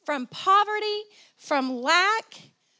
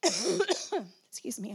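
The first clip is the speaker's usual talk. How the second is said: ordinary talk, nothing marked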